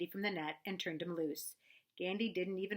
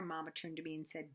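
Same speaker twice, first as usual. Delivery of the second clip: ordinary delivery, nothing marked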